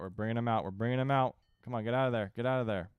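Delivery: loud, pressing call; urgent shouting. Real, not acted. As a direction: shout the order to pull out. Pulling troops out of battle.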